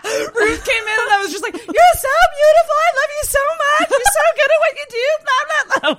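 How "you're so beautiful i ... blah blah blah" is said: very high, loud mock gushing; squealed self-parody of gushing; imitated gushing compliments. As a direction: overwhelm them with praise, laughing. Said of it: "overwhelming you with compliments".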